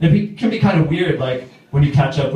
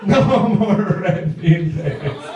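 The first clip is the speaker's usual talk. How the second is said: very slow, higher-pitched plea; mock despair; 5 semitones higher, much slower than usual. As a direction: drag it out in comic despair. Despairs at yet another gift.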